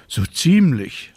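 slow, yawning reply. A narrator's reading. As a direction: say it through a yawn. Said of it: "sagte die kleine Hexe und gähnte".

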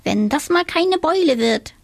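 high, cheeky little witch voice; a squeaky young witch grumbling. A narrator's reading. Little witch, grumbling about her bump, squeaky voice.

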